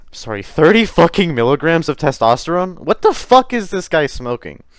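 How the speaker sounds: loud, high-pitched disbelief; incredulous outburst. Real, not acted. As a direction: blurt it out, appalled by the absurd number. Incredulous reaction to a quoted dosage.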